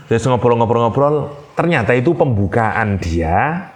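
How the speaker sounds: ordinary talk, nothing marked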